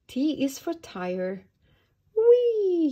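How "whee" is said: high, loud, drawn-out whee; joyful squeal, sliding down in pitch; gleeful yell. A narrator's reading. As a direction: yell it as if rolling downhill. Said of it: "yelled Violet as she rolled down the hill".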